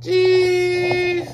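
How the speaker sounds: put-on high squeaky toddler cheese; baby voice. Shown as a demonstration. Dad echoing his toddler's cheese, high squeaky voice.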